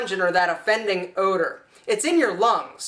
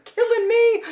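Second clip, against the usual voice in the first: high squeal; 13 semitones higher, 4 dB louder than usual. Retold or imitated. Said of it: "been like".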